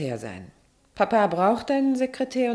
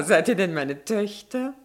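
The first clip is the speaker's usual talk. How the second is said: ordinary delivery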